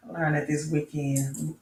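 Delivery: ordinary talk, nothing marked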